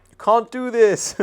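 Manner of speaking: high, loud, slow alarmed outburst; paranoid panic. Retold or imitated. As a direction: cry out like your own paranoia. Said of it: "my paranoia kind of kicks in".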